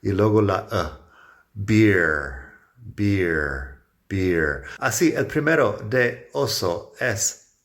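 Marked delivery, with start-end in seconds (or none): 1.5-4.6 "beer" with a long i then a short e glide; teacher drilling beer. Shown as a demonstration. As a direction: repeat "beer" with a drawn-out i. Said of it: "Empiezo con una I como I larga".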